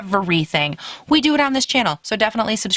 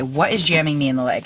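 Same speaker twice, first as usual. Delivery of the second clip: normal talking voice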